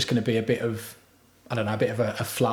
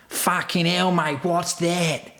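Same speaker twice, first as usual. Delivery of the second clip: high-pitched, drawn-out mock shout of a partner; Dieter impression; 5 semitones higher, much slower than usual. Partner calling out at overheard toilet noises, high mocking impression. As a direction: imitate a shocked partner, pitched up and stretched out. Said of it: "I'm gonna do an impression".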